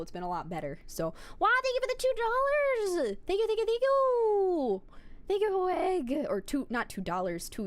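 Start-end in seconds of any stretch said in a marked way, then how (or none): 1.4-6.3 much higher, gushing thank-yous; over-the-top delighted donation thanks; excited thanks. Real, not acted. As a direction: squeal out the gratitude.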